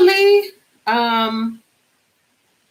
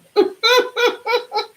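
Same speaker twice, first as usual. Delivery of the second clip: high laugh; 11 semitones higher than usual. Real, not acted. Laughs at Danielle.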